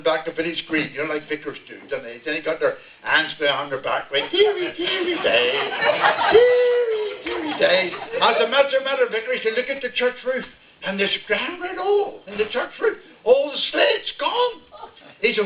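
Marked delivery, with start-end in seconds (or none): 4.3-7.9 high, fluting vicar voice with a laugh; mock vicar. Vicar voiced in a told joke. 9.6-14.6 lightly voiced vicar. Vicar voiced lamenting the roof.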